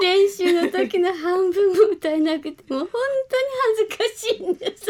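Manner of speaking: high, loud, flustered protest through laughter; embarrassed laughing. Real, not acted. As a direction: laugh while begging off in embarrassment.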